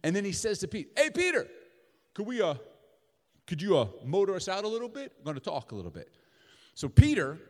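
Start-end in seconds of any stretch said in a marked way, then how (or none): 1.0-6.1 higher, louder easygoing Jesus; breezy ask to motor out; casual request. Retold or imitated. Jesus casually asks Peter.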